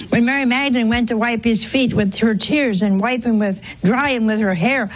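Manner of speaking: ordinary delivery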